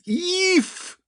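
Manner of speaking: high, loud little yelp; startled squeal. Retold or imitated. Co-host voicing a girlfriend surprised by a kiss, startled squeal. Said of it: "and she goes".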